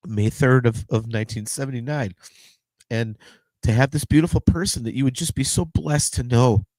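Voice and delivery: fighting back tears over his brother; getting weepy. Real, not acted. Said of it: "sorry for getting all weepy".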